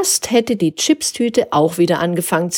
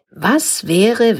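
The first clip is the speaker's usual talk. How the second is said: ordinary delivery, nothing marked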